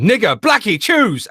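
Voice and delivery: very high, loud blurted shout; imitated tic shout. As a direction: yell it like an uncontrollable tic. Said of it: "she just kept saying".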